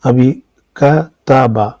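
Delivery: کتبہ said slowly, ka-ta-ba with open vowels; slow demo word. Shown as a demonstration. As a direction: carefully sound out کتبہ for the class. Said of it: "اس کا مطلب اس نے لکھا".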